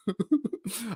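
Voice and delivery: soft, high giggle; real giggle, high and light; amused laugh. Real, not acted. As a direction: chuckle helplessly at your own story. Laughs at losing his credit card.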